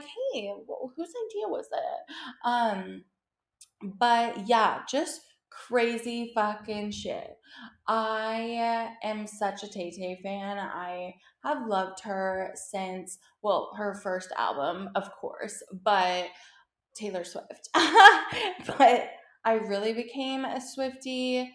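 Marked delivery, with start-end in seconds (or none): 0.0-2.1 higher, softer breezy greeting; mock sweet phone call. Pretend call to Taylor, lightly higher sweet voice. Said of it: "be like". 17.8-18.6 a burst of high, loud laughter. Real, not acted. Vlogger laughing at herself, loud high laugh.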